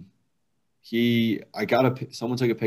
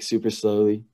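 ordinary talk

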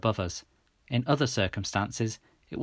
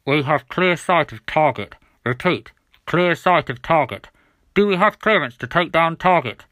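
police radio voice; 5 semitones higher, 8 dB louder than usual. A narrator's reading. Helicopters requesting clearance on target, radio voice. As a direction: report in like a pilot on police radio. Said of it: "a voice came on to the police radio".

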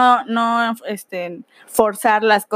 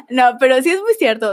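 ordinary talk

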